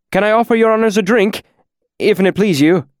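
high, fast nervous offer; quick eager offer. A narrator's reading. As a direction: rush the offer out anxiously. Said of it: "the owner offered quickly".

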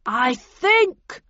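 high, loud projected answer; bullhorn reply. A narrator's reading. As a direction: call back through a bullhorn. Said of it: "replied the voice on the bullhorn".

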